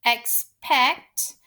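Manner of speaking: EXPECT in two parts, EX, pause, PECT; split into syllables. Shown as a demonstration. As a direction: break EXPECT after EX. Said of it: "My second syllable".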